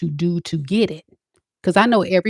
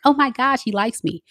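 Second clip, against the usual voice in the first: put-on giddy girl; 6 semitones higher than usual. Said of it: "you get all giddy".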